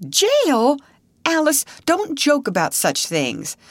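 high-pitched scandalised protest; shocked. A narrator's reading. Sister to Alice who says she was in jail, shocked. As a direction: react with a high, shocked reproach.

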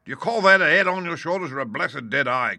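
high, scornful outburst; crying out. A narrator's reading. Landlord scolding dim sailor, crying out. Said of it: "cried Long John".

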